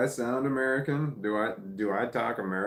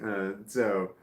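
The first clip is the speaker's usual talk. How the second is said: ordinary talk, nothing marked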